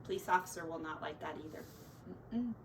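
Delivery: ordinary delivery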